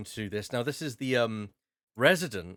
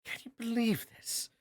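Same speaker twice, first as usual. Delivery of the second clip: high, put-upon noble lady; exasperated baroness; 4 semitones higher than usual. Acted. Baroness turning to the players, high and exasperated. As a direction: complain to the players like a spoiled aristocrat. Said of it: "sighs and turns around to you and says".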